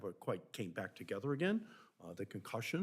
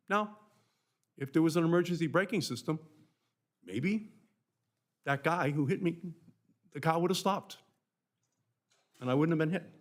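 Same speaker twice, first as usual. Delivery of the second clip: louder and higher, pressing the point hard; impassioned raised voice; 7 semitones higher, 8 dB louder than usual. Real, not acted. Senator arguing for braking systems, loud and impassioned. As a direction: push it out with rising heat.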